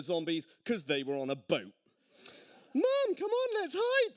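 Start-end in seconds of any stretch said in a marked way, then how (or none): 2.7-4.1 put-on high-pitched urgent child voice; much higher little boy voice; young son. A narrator's reading. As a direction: plead like a small boy tugging at his mum. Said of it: "the son said".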